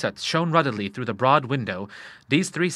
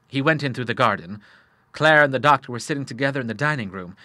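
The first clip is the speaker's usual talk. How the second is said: ordinary delivery, nothing marked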